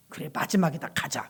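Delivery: tired whisper; whispered reply. Retold or imitated. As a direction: breathe it out without voice. Pastor answers wife quietly.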